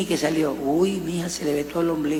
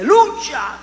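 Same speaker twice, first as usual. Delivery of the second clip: a higher, louder cry of Lucha, like a fervent worshipper; mock hallelujah cry; 8 semitones higher, 6 dB louder than usual. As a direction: call out Lucha like a churchgoer praising. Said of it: "Le daba los aleluyas así".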